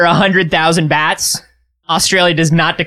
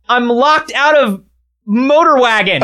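higher, slow dim-witted cry for help; idiot in trouble; 5 semitones higher, slower than usual. Acted. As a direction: act helpless and dumb, calling out haltingly. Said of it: "that sounds like an idiot".

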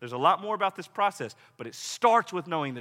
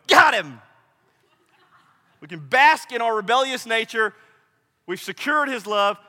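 mock gloating kids; 6 semitones higher, 9 dB louder than usual. Boys imagined gloating after forgiveness.

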